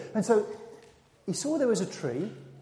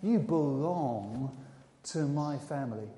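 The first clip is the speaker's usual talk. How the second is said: very slow, low and soft reassurance; hushed, deep, unhurried tenderness; tender welcome; 7 semitones lower, 9 dB quieter, slower than usual. Acted. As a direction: welcome them gently, stretching each word. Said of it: "he wants to welcome you".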